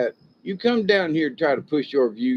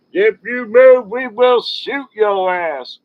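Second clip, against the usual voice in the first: loud, much higher, slow bullhorn command; loudspeaker warning; 7 semitones higher, 8 dB louder, slower than usual. Retold or imitated. Police over a helicopter loudspeaker, loud slow warning. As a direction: bark it down from the helicopter. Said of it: "over the loudspeaker".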